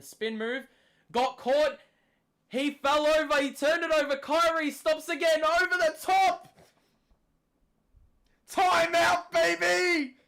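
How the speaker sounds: yelling timeout after a big run; hype commentator yell. Real, not acted. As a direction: scream the run like a fan announcer. Live play-by-play during scoring run.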